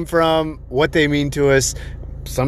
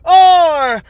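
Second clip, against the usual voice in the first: booming high showman announcer; slow, loud circus ringmaster call; 14 semitones higher, 4 dB louder, slower than usual. Acted. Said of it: "Gotta start off that energy fucking high".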